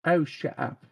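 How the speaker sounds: quick put-on English accent, tossed off; mock English accent. Co-host teasing the host, mock English accent. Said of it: "mock English accent".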